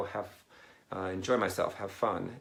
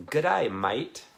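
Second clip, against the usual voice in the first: loud, higher-pitched Australian greeting; Australian accent; 5 semitones higher, 6 dB louder, slower than usual. Shown as a demonstration. Streamer to Australian viewer trying the accent, playful Australian accent. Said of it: "that's a pretty good, uh, accent".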